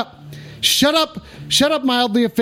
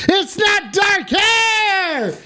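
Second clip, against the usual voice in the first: high, slow drawn-out shout; 8 semitones higher, much slower than usual. Real, not acted. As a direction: yell it out, stretched. Excited streamer outburst.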